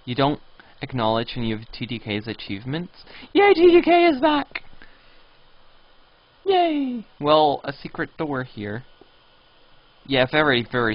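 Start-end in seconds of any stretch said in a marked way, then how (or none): 3.3-7.1 high, delighted cheer. Real, not acted. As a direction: celebrate his return. Cheering player's return.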